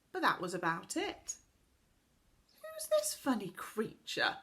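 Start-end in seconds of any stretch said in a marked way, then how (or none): 2.6-4.4 put-on rude girl, higher voice, pointing someone out; dismissive child sneering at a stranger; loud and rude. A narrator's reading. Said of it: "in a loud and rather rude voice".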